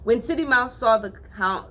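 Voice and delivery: usual speaking voice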